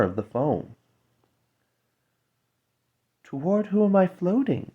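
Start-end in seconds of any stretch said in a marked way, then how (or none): 3.2-4.7 higher, airy wondering question; ethereal mermaid voice. Acted. Little mermaid asking the air spirits where she floats, high and ethereal. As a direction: ask as if floating away, higher. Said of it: "her voice sounded like the other's, so ethereal".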